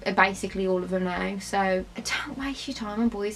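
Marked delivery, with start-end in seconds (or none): none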